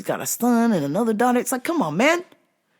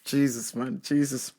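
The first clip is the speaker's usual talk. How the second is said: ordinary talk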